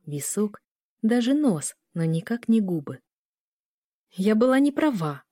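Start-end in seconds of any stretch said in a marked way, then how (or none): none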